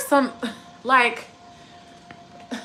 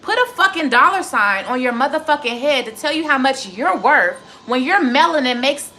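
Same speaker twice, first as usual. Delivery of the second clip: normal talking voice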